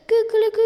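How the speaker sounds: loud, high cock-a-doodle-doo; rooster crow. A narrator's reading. Rooster making a racket to get the animals' attention, loud high crow. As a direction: crow at full voice. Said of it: "Hij boog zich zijn kop achterover".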